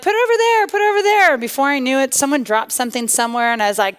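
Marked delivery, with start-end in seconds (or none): 0.0-1.3 high-pitched imitation of a nagging mom; bossy mom. Mom directing kids at the wood pile, bossy. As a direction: order the kids around, pitched way up.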